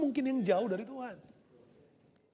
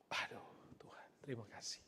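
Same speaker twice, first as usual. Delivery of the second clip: breathy whisper of thanks to God; hushed, grateful whisper; 14 semitones lower, 24 dB quieter, faster than usual. Acted. Son whispering thanks to God, hushed and grateful.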